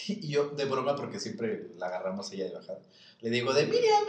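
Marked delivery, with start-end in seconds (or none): 3.5-4.0 teasing shout. Retold or imitated. Teasing reply shouted in story.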